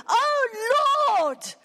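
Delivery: high, loud, crying out to God; emotional cry. Shown as a demonstration. Preacher showing how Daniel cries out, emotional cry. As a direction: cry it out from the heart. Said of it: "It's an emotional crying to God".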